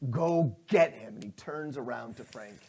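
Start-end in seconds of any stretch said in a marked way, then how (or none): 0.1-1.1 cold slow order. Acted.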